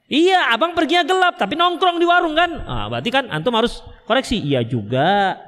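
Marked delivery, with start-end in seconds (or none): 0.1-2.6 high-pitched mock wifely retort; bantahan istri. Istri membantah alasan suami. 4.4-5.4 low, drawn-out concession; mengalah mengakui. Acted. Suami mengakui bantahan istri.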